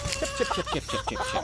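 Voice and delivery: very high, soft chip-chip calling; high soft chicken-calling chant. Real, not acted. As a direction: call the bird in with a squeaky high chant. Calling the rooster by name.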